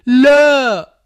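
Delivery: very high, loud shriek of "no!"; a spirit mother screaming as she loses; mother's scream. A narrator's reading. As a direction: scream in fury and loss. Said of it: "صرخات الأم".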